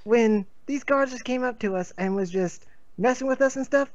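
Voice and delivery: rising, excited yarn; excited tall tale. Acted. Character tells ferret legend excitedly.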